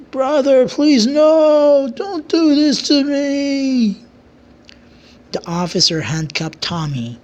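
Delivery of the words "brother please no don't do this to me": high, loud wailing plea, slowing at the end; desperate pleading. A narrator's reading. Tommy begging his brother the king, high wailing plea. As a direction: cry out to your brother for mercy. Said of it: "still pleading to the king".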